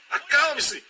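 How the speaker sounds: high, loud "Acalme-se" called out; pleading high cry of "Acalme-se"; urgent command. Acted. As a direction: insist they be still. Repeating command, rising intensity.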